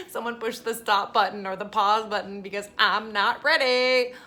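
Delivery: loud, rising plea, dragging out the end; half-laughing overdramatic cry of protest; mock panicked protest. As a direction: plead loudly to stop everything. Acting out her initial shock.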